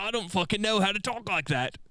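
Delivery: high-pitched, badly faked Scottish accent; joking botched Scottish accent. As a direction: put on a hopeless Scottish brogue. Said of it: "Still bad".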